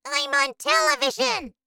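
put-on tiny, squeaky-high boy; very small voice. A narrator's reading. Shrunken boy greeting his parents in a tiny voice. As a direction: pipe up like a miniature child. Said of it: "in a very small voice".

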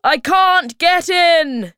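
high, loud, slow complaint; shouting. A narrator's reading. Hemulin unable to follow the others, loud shout. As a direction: yell out in frustration. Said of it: "he shouted".

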